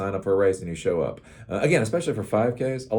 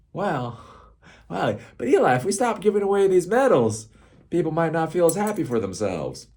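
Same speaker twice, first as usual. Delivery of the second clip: mocking objector; 9 semitones higher than usual. Mock objector voice.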